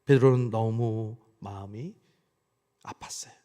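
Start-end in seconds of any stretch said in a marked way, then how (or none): none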